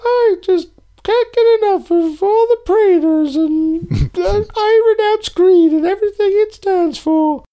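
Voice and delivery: put-on earnest squeaky kid voice; high silly voice.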